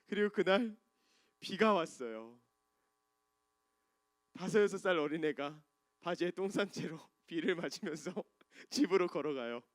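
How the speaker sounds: normal talking voice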